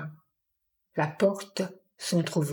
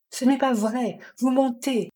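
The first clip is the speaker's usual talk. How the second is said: higher-pitched, indignant accusation; mildly heated denial, voice raised in pitch; accusing; 6 semitones higher than usual. A narrator's reading. Jim tells the woman she is lying, accusing.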